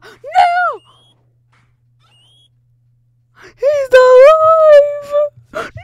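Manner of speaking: excited shout. Real, not acted. Friend survives unexpectedly.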